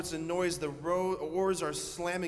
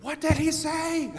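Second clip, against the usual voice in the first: high, loud, baffled shout; high squeaky disbelieving voice; incredulous Peter; 13 semitones higher, 10 dB louder than usual. Retold or imitated. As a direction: yell back across the boat in disbelief. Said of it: "He's going".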